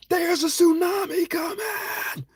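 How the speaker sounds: high, drawn-out calling shout; screaming a warning. Retold or imitated. Warning people on the beach while pointing, screaming. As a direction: yell it while pointing at them. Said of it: "I'm screaming with everything I got".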